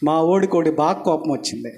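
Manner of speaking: ordinary delivery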